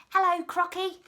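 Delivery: high, loud monkey voice; chirpy monkey. A narrator's reading. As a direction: pipe up like a cheeky monkey. Monkey greets the crocodile.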